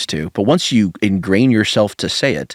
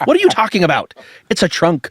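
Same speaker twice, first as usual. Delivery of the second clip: exasperated retort; 9 semitones higher than usual. Retold or imitated. Acts out Anna's baffled objection.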